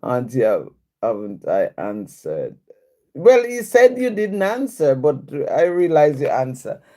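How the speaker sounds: usual speaking voice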